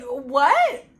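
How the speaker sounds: high, loud mock-shocked cry; playing up incredulous surprise; disbelieving. Vlogger voicing her old self's shock, disbelieving.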